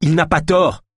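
high, loud exasperated yell; angry outburst. A narrator's reading. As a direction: shout them down in irritation. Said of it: "lui jeta un regard courroucé".

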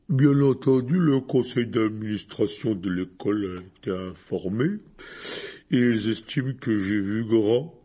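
a tipsy giant blaming himself; drunk remorseful giant. A narrator's reading. Hagrid figure, drunk and guilty.

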